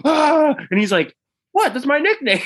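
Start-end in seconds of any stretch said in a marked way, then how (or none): 0.0-0.6 loud high-pitched mock shriek; imitated scream of horror. Retelling the offended guy's reaction, mock scream. 1.5-2.4 high-pitched defensive squeal, half laughing; mock protest. Larry protesting to the upset guy, high mock protest.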